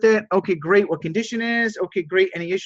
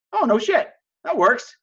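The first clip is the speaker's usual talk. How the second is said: high-pitched, pleasantly surprised acceptance; acted pleased agreement; 7 semitones higher than usual. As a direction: play the friend happily won over. Acted friend accepts the compromise.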